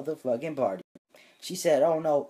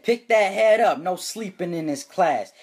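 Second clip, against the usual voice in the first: sharp, loud teacher bark that eases off; teacher yelling; 5 semitones higher, 5 dB louder than usual. Pretend. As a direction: snap at the student to sit up. Teacher scolding sleeping student.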